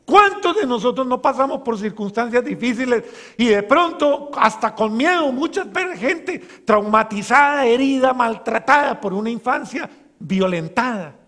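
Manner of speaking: loud, impassioned sermon voice; fervent preaching. Acted. Preacher rousing the congregation with a loud, fervent appeal.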